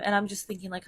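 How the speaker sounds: ordinary delivery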